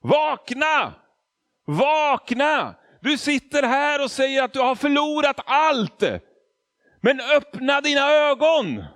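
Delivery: high, loud shouted wake-up call; retold shout. Pastor shouting at the lost man to wake up, loud. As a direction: yell it like a preacher shaking someone awake. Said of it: "skrek han till mig".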